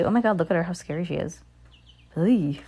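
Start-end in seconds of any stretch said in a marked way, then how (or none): none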